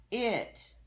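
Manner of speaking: loud, high shout of the word; hollered. Acted. Amelia answering roll call, loud holler. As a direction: holler it out proudly. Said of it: "hollered Amelia Bedelia".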